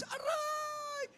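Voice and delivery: long, high yelp of pain; acted cry of pain. Person hurt by the door once alone, hammed-up pain scream. Acts finger caught in a door.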